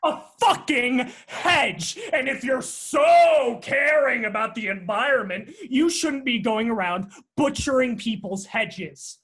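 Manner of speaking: yelling about the hedge; furious shout. Acted. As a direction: shout it in outrage. Heated neighbour quarrel over hedges.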